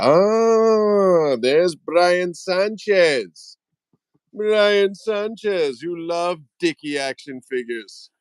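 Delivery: high, drawn-out hail to a friend; excited greeting. Real, not acted. Host spots a viewer joining live, excited greeting. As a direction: stretch the name out high and slow.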